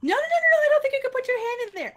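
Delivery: very high, loud, rapid-fire protest; acting out an urgent high-pitched stop; alarmed objection. Evaluator stops child in story.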